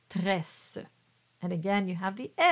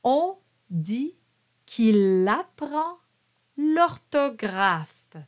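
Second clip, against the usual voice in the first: slow French, phrase by phrase with pauses; slow pronunciation model; 3 semitones higher, 4 dB louder, slower than usual. Shown as a demonstration. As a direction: model each French phrase slowly for learners to copy. Teacher models French lyrics phrase by phrase.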